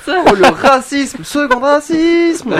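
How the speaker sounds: high, slow, loud mock indignation; mock outrage. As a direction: drag it out in fake outrage. Moquerie outrée entre joueurs. (indignation exagérée, aiguë et lente)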